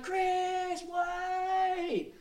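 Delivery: imitated high, long-held shout; retold calling after someone. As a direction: mimic a distant holler to stop. Man shouting after him.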